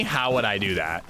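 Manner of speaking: ordinary talk, nothing marked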